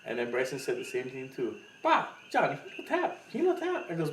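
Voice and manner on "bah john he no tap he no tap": teasing quote. Retold or imitated. Partner teasing in pidgin.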